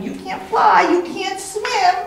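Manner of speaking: squabble back and forth up high; mock argument. Bird and duck bickering, high and squabbling.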